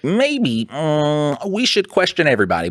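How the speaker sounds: high mocking detective voice; hammed-up sleuth. Mocking what detective should say.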